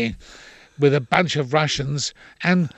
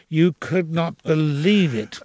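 ordinary delivery

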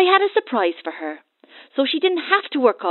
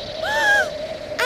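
gasp; 3 dB quieter than usual. Acted. Child sees crocodile.

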